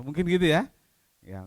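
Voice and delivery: ordinary talk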